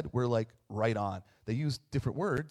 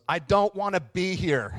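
imitating a sulky little boy, higher, louder and slow; sulky kid; 6 semitones higher, 8 dB louder than usual. Pastor imitating Yuli refusing church, whiny and drawn out. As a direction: do the grumpy kid who refuses to be there.

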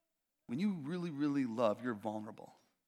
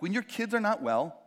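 ordinary talk

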